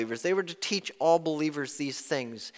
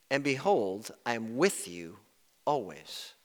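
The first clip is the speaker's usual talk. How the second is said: soft tender quote; 6 semitones lower, 7 dB quieter than usual. Acted. Quoting Jesus's promise softly.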